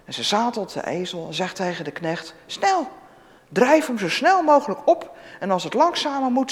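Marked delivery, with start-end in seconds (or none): 2.5-6.5 high, urgent order to hurry; mother urges the servant to hurry; urgent command. Acted. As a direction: push the servant on, high pitched.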